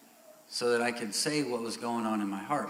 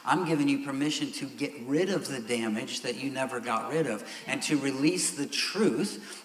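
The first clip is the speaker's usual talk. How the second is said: ordinary talk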